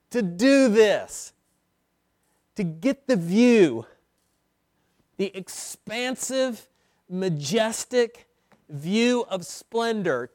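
each phrase spaced, slow and loud; slow preacher's emphasis; slow emphatic build. Acted. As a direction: savor the words expansive, majestic. Slowed, emphatic list.

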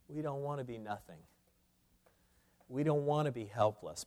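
soft undertone; hushed reluctance. Acted. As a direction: confess it under your breath.

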